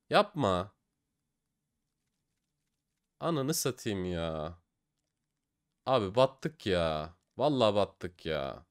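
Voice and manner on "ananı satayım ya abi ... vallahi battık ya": slow, low, soft and defeated; sinking into a loss; dejected groan. Real, not acted. Vlogger watching his balance drain, quietly defeated. Losing money, deflated.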